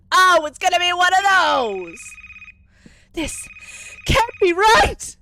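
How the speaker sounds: high loud exasperated shout; frustrated yell. Real, not acted. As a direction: rage at the game. Frustrated outburst at game.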